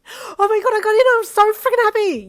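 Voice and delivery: very high, loud, joyful screech. A narrator's reading. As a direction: screech it, words rolling together. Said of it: "you screeched".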